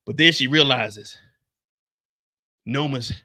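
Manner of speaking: winded from talking fast; out of breath. Real, not acted. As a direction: gasp between words. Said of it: "I'm out of breath".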